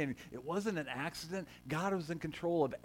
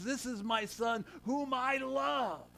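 slow, higher, solemn divine declaration; drawn-out heavenly announcement of love; voice of God; 7 semitones higher, much slower than usual. Retold or imitated. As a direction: proclaim it slowly like God's voice from the cloud. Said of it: "And God's voice came and says".

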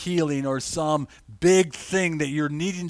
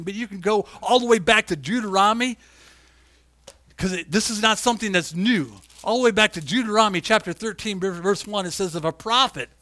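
louder, higher, building to a shouting climax; fiery preaching; 4 semitones higher, 6 dB louder than usual. Acted. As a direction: preach it hard, warning of what's coming. Preaching at full force again.

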